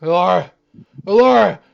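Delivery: put-on loud slurred drunk warning; town drunk. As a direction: shout it like a drunk. Drunk Tom shouts warning.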